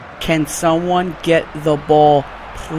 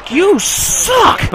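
shouted taunt; 10 semitones higher than usual. Real, not acted. Taunting the game.

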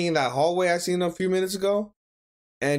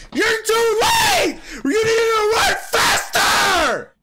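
very high, drawn-out full scream; frantic screaming; 5 dB louder, much slower than usual. Real, not acted. Viewer begging an anime hero to run faster, frantic screaming. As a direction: scream in desperation at the screen.

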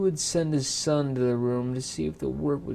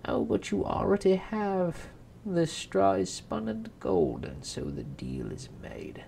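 scheming imp; 4 semitones higher than usual. Acted. Imp claims his bargain.

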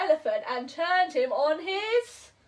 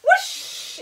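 whoosh; 13 semitones higher than usual. Acted. Reading to a child, a bright whoosh. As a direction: let the water rush out. Water rushing from the trunk.

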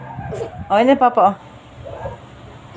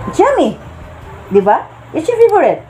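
high sing-song it's yummy; 6 semitones higher than usual. Real, not acted. Mother urging her baby to eat, high coaxing voice.

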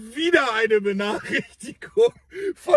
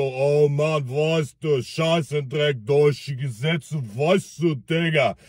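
put-on lower, slurred Russian accent; drunk Russian accent; 9 semitones lower, slower than usual. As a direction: drunk Russian brushing off the police. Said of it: "Mit so einem russischen Akzent". (tiefer betrunkener russischer Akzent)

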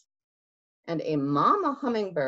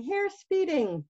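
ordinary delivery, nothing marked

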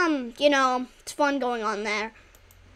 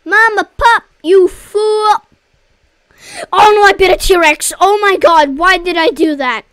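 panicked shout; 2 semitones higher, 9 dB louder than usual. Real, not acted. Panics after biting T-Rex.

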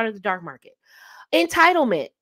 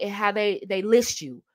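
ordinary talk, nothing marked

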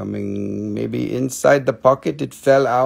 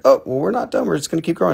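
a touch higher and louder, cheerful resolve; lightly voiced plucky crystal; 8 semitones higher, 6 dB louder, much faster than usual. Host voicing a broken crystal, lightly higher and determined. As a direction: give the crystal a light, brave little voice.

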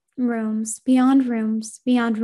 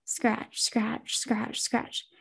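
soft scratching; 9 dB quieter, much slower than usual. Acted. Eerie scratching sound in dark room.

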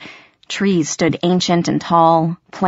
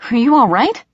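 concerned; 6 semitones higher, 4 dB louder than usual. Acted. Puck checks on shaken girl.

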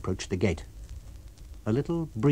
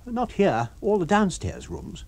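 higher, dry character voice; lightly voiced wry man; Kemp voice; 9 semitones higher than usual.

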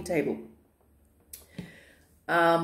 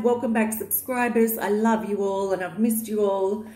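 normal talking voice

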